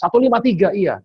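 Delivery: jumps high, suddenly getting it; the penny drops, loud and high; startled realization. Retold or imitated. Petrus kaget. (kaget, suara tinggi)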